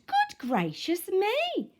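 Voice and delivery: high, slow amazed exclaim; a startled exclamation. A narrator's reading. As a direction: gasp it in wonder.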